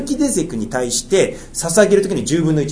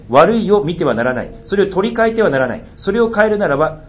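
measured, solemn Bible reading; scripture reading; 5 semitones higher than usual. Acted. Pastor reading scripture to the church, solemn and measured. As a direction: read the law aloud to the congregation. Reads Leviticus aloud.

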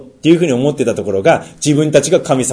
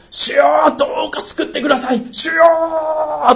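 crying out to God. Acted. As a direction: call out desperately. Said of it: "叫ぶんですよ". (神に向かって叫ぶ)

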